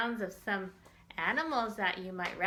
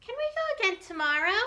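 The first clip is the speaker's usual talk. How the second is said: put-on much higher, louder and fast, like an excited little kid; eager child voice; 13 semitones higher, 5 dB louder, much faster than usual. The child in the story begs to go again, excited.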